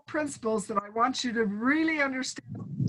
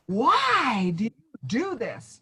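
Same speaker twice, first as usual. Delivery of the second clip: accusing question; slower than usual. Pretend. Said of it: "instead of".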